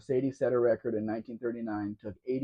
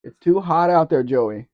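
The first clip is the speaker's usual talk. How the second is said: raised, loud call to Joey; 4 semitones higher, 11 dB louder than usual. Real, not acted. Host warning Joey about the heat, calling out loudly. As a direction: call out higher and much louder.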